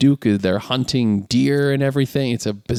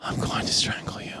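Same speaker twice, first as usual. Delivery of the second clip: whispered playful threat; mock menacing whisper; 10 dB quieter than usual. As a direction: breathe it out, no voice. Joking threat.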